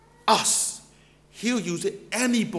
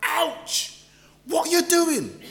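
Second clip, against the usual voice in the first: pained shout; 9 semitones higher, slower than usual. Retold or imitated. Balaam shouting at his donkey after it crushes his leg, high pained yelp. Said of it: "I hear him shout".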